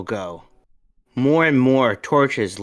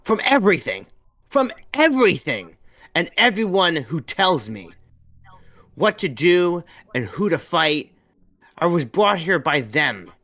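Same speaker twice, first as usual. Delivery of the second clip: higher, louder angry cry; angry outburst; 7 semitones higher, 5 dB louder than usual. A narrator's reading. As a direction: lash out in frustration. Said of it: "with a spark of anger in her voice".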